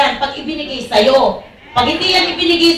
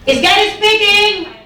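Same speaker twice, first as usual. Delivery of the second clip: slow, loud call to the church; 5 semitones higher, 4 dB louder, slower than usual. Real, not acted. Preacher calling out to his congregation, slow and loud. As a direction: ask the church aloud, drawn out.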